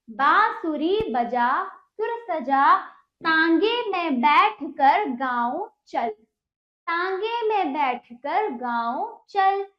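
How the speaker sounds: slow, word-by-word model reading; deliberate teacher's read-aloud, nasal vowels held; pronunciation reading drill. Shown as a demonstration. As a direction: stretch each word for pupils to follow. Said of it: "देखते हैं हम इसका उच्चारण".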